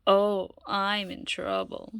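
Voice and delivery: slow, drawn-out worry; slow worried lament. Acted. Loretta dreading going home, slow and drawn out. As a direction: stretch it out in dread.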